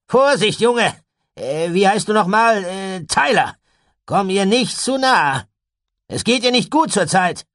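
sehr lauter, hoher Warnruf; scharfer Warnruf. A narrator's reading. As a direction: ruf den Jungen scharf zurück. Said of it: "erhob Gideon scharf die Stimme". (scharf und laut gerufen)

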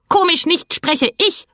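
high, outraged bird cry, rising at the end; indignant cry. A narrator's reading. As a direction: shoot up in offended protest. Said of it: "rief der Plappergei empört".

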